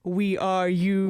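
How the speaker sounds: slow, eerie quote; eerie poetic voice. Retold or imitated. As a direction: say it slow and haunting. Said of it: "to being like".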